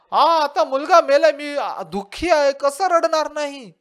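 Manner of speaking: a mother pleading that she must cry; grieving woman protesting. Retold or imitated.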